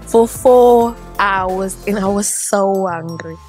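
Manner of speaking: slow, loud, fed-up groan; exasperated drawl. Real, not acted. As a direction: stretch it out, exasperated. Complaining about the power cut.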